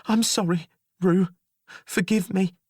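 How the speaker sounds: high, tearful apology. Acted. Jailer apologising before she flees. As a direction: apologise high through tears.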